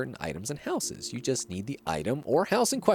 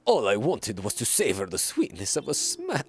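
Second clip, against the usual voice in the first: deliberately bad, inauthentic French accent. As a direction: play it as a silly fake Frenchman. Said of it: "give him, like, a French accent".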